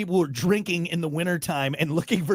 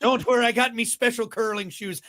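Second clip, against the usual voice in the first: higher-pitched cartoon Irish accent; Lucky Charms guy; 5 semitones higher than usual. Drunk curler reassuring others about ice safety, cartoon Irish accent. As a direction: slip into a jokey Irish leprechaun brogue. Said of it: "Why did I turn into the Lucky Charms guy?".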